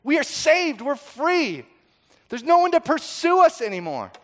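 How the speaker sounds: high, loud rejoicing; jubilant. Acted. Freed people rejoicing after the sea, high and loud. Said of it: "And they burst into song".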